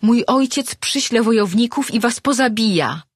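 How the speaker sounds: high, loud, furious threat; angry threat. A narrator's reading. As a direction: threaten them with her father's warriors. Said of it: "czując, jak wzbiera w niej gniew".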